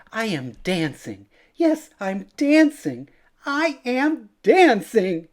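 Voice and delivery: building to a high, loud shout of joy; joyful cry. A narrator's reading. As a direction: burst out with delight. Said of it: "Gerald cried".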